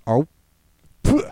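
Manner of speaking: brief oh, then puffed spit; imitated spit. The cook tasting her own dish, imitated spit. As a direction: mimic tasting, then spitting it out.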